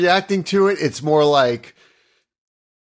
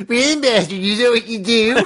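higher, whining nerd mimic; whiny nerd voice; 6 semitones higher than usual. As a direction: parrot it back like a whiny nerd. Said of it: "in a whiny nerd voice".

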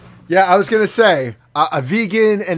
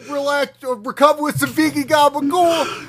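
higher-pitched, hammed-up Jersey boasting; mock New Jersey accent; 4 semitones higher than usual. Continues the Jersey character's boasting.

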